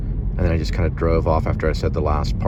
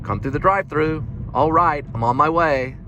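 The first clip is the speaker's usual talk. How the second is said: high cheerful worker; 9 semitones higher than usual. Retold or imitated. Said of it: "she's like".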